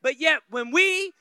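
loud, climbing sermon delivery, pushing harder; fiery preaching. Acted. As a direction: preach it to the back pews. Preaching builds to full force.

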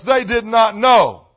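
usual speaking voice

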